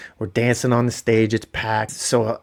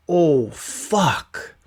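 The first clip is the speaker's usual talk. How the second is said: put-on higher, small child voice saying it; a little kid muttering at dropped food; mimicking toddler son; 5 semitones higher than usual. Dad imitating his three-year-old swearing at dinner, mild child voice.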